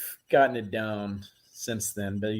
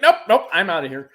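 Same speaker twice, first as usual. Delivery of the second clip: mock alarm; 8 semitones higher, 7 dB louder, faster than usual. Imagined fleeing a creepy doll.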